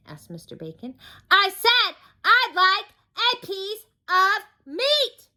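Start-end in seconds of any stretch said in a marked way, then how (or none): 1.3-5.3 very slow, high, loud roar. Acted. Mister Noisy roars at the butcher who pretends not to hear, roaring. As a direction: roar it word by word. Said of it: "roared Mister Noisy".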